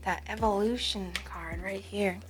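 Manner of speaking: soft unvoiced whisper to herself; hushed whisper pointing at the card; whispered aside. Real, not acted. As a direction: breathe it out as a private aside. Whispered aside over the cards.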